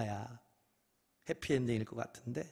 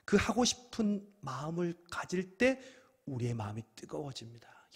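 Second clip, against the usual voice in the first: ordinary talk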